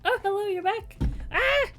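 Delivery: high, loud startled greeting; surprised squeal. Real, not acted. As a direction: caught off guard by the dog. Surprised by the dog.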